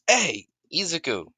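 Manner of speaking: high, loud friendly hail; calling out. Acted. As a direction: call him from across the room. Hunter calls out to Izuku.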